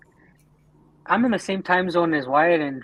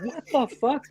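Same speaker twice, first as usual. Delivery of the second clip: high-pitched retold bafflement; mock disbelief; 9 semitones higher, 4 dB quieter than usual. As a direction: replay your amazement, pitched up without shouting. Acts out his stunned reaction.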